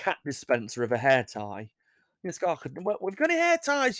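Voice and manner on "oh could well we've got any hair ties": mock high voice. Voicing the cat owner asking.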